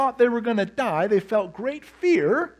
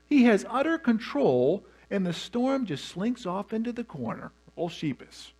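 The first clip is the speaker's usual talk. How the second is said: ordinary talk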